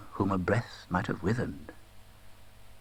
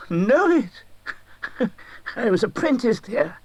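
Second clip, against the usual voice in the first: put-on high, thrilled old man; bursting with recognition; delighted Scrooge; 12 semitones higher, 5 dB louder, slower than usual. A narrator's reading. Scrooge to the Ghost, thrilled to see his old workplace.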